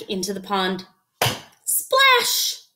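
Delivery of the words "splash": plain reading voice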